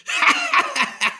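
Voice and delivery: big mocking lion laugh; hearty scornful laugh; booming laugh. A narrator's reading. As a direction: laugh out loud like a proud lion. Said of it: "The lion laughed out loud".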